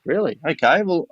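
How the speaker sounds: hearing Cam kept training, surprised and high. Retold or imitated. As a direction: pitch jumps up in surprise. Surprised at the news.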